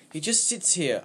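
high, loud, upset outburst; distraught boy near tears; tearful. A narrator's reading. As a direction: burst out in tearful frustration. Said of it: "There were tears in my eyes".

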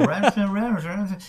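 high nonsense syllables imitating English; mock English gibberish; fake-English gibberish. Shown as a demonstration. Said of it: "es básicamente hacer como".

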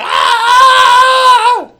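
loud, high laughing yell; cracking up while yelling; laughing scream. Retold or imitated. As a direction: shriek through the laughter, overwhelmed by the song. Retelling running from car, overwhelmed.

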